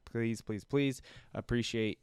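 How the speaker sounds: ordinary talk, nothing marked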